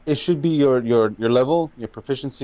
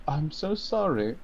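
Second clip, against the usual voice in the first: higher, subdued apology; apologetic; 3 semitones higher, 7 dB quieter than usual. Acted. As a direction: say sorry as the shamefaced character. [name] apologizes in character.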